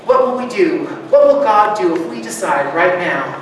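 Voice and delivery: ordinary talk, nothing marked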